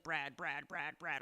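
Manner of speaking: low repeated phone-message voice; hint of a man on voicemail; lightly imitated caller. Brad leaving message after message, lightly imitated low voice.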